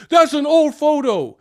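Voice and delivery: high, loud whiny mock fan voice; squeaky mocking fan. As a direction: sneer it like a touchy fan. Mocking fans' complaint.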